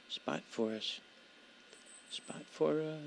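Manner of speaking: soft, hushed pleading; quietly urging the game on; wildlife watching whisper. Real, not acted. Waiting for elk, keeping still.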